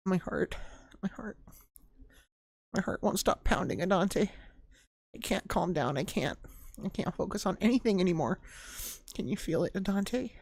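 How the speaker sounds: put-on a shy girl whose heart is racing; breathless Yuri. Voices Yuri losing control.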